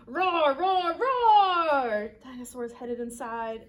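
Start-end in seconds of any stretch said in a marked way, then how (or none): 0.1-2.1 loud, high roars, last one longest; boisterous triple roar; dinosaur roars. Acted. Reads aloud Dinosaur's roars.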